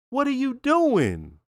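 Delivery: high, slow, exasperated repeat. Retold or imitated. Host repeating his question to Chris, high and exasperated. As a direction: stretch it up in disbelief at Chris. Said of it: "it's like".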